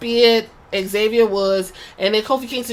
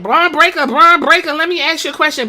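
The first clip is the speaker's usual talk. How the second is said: higher, eager calling out; eager interviewer; 6 semitones higher, 4 dB louder than usual. Retold or imitated. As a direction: call out to him eagerly. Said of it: "Jackie Redmond was like".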